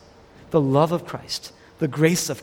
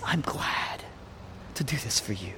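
very soft, lower, tender voice; tender acceptance; 5 semitones lower, 11 dB quieter than usual. Acted. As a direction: say it gently and hushed, with a pause. Jesus tenderly accepting suffering.

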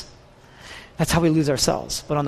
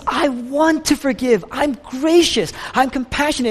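God's earnest invitation; 9 semitones higher, 4 dB louder than usual. Retold or imitated. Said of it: "He says".

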